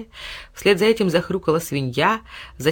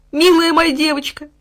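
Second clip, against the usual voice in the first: high, loud overjoyed cry; joyful dog; 10 semitones higher, 6 dB louder than usual. A narrator's reading. Dog leaping to the girl, high joyful cry. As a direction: burst with joy.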